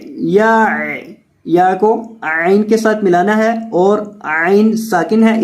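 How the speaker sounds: ordinary talk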